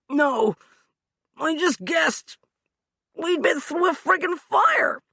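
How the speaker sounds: high, loud, strained excuse; pained protest. Acted. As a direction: wriggle out of blame. Hugh defending himself in pain.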